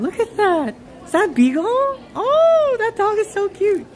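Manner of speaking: high, delighted squeal; gushing over a dog. Real, not acted. Spotting the beagle at their leg, gushing. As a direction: coo high at a cute animal.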